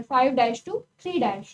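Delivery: Five dash to three dash spelled out slowly, every word separated; slow, deliberate. Shown as a demonstration. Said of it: "ध्यान ये देखने के कहाँ से बोले".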